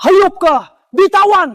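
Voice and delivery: high, angry yell; angry outburst. Acted. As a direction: curse and demand release. Cursing an attacker.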